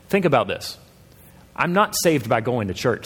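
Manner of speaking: ordinary delivery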